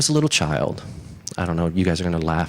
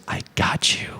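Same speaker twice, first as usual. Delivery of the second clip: voiceless, sly whisper; gloating whisper; 3 dB quieter than usual. Acted. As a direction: whisper it like a trap just sprung. Fox springs trap on rabbit.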